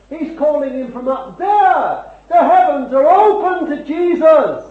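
high, loud, exultant proclamation; impassioned preaching. Real, not acted. Preacher declaring the heavens open to Jesus, impassioned preaching. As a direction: proclaim it at the top of the sermon.

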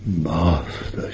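reverent whisper; whispered Master. Acted. Mary calling Jesus Master in a whisper. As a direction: breathe the word softly. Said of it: "she called him".

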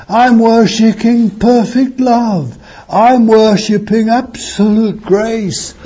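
high, ringing pulpit voice; fervent preaching. Acted. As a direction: preach it out with full conviction. Preaching with rising fervour.

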